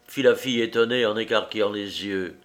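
usual speaking voice

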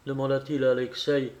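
ordinary delivery, nothing marked

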